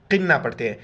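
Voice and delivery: ordinary talk, nothing marked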